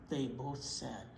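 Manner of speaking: plain reading voice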